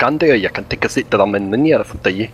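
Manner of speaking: put-on Scottish accent. Player joking with teammates, mock Scottish accent. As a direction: speak in a thick, muddled Scottish accent. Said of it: "That's a really good Scottish accent".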